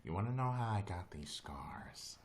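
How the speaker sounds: breathy whispered menace, doing the Joker; whispered Joker quote. Retold or imitated. Streamer quotes the Joker to chat in a whisper.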